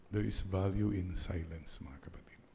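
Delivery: soft unvoiced whisper. Real, not acted. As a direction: breathe it to the congregation. Preaching the value of silence. (pabulong na pangaral)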